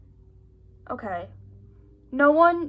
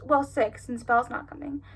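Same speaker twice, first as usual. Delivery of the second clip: usual speaking voice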